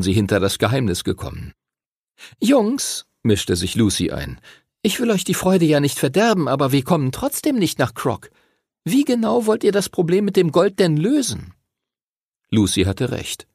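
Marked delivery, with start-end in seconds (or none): 2.4-3.0 put-on girl interjecting. Lucy breaks in on boys. 4.8-11.5 put-on high-pitched, sceptical girl's voice; girl objecting. Voices Lucy challenging the boys.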